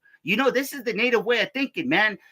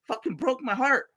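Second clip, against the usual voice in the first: normal talking voice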